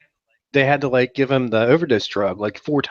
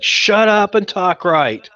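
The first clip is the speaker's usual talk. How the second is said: slow, exasperated and higher; mock fed-up outburst; exasperated snap; 7 semitones higher, 4 dB louder, slower than usual. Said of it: "and then it's like".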